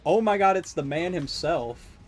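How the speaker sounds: loud, slow grand announcement; excited. Real, not acted. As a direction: hype up the man himself. Greeting famous killer character.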